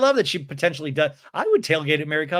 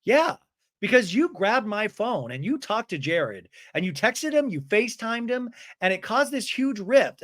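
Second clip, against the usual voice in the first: high mock-upset accusation; Brittany; 5 semitones higher than usual. Woman accusing friend over phone meddling, in high mock upset. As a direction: pile up the complaints in a raised pitch. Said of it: "And Brittany's like".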